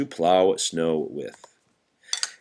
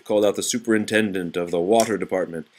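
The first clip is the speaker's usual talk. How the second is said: ordinary delivery, nothing marked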